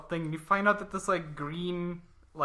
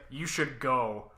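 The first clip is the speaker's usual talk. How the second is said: deadpan, slow and low; slow low Broly voice; 3 semitones lower, slower than usual. Retold or imitated. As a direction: calmly warn him off. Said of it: "And he's like".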